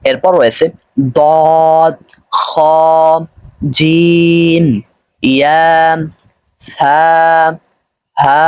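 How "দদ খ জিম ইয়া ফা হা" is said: "দদ, খ, জিম" and the rest held long; drawn-out letter recitation. Shown as a demonstration. Teacher reading a line for learners, "দদ, খ, জিম" drawn out. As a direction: slowly stretch each name from "দদ" to "হা". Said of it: "টান সহকারে আয়ত্ত করতে হবে".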